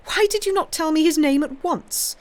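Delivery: high, loud sharp rebuke; alarmed and reproachful; anxious demand. A narrator's reading. King rebuking Blacas for withholding the name, loud alarm. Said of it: "a faint shadow of anxiety".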